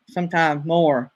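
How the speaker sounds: usual speaking voice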